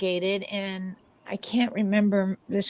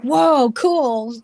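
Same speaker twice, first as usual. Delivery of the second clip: excited whoa; 5 semitones higher, 5 dB louder than usual. Retold or imitated. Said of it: "And I'm like".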